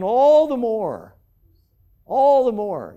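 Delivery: a preacher building up the mob's fury; preaching at full force. Real, not acted. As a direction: drive the repetition home with force.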